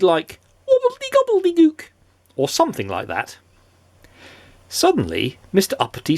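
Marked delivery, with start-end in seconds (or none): 0.7-1.9 very high squeaky gibberish; goblin magic word. A narrator's reading. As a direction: chant it like a little goblin's spell. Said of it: "a magic word known only to goblins".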